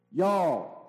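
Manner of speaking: a hint of a Texan accent on "Y'all"; Texan drawl. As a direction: drop into a light Texan "Y'all". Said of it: "So in Texan".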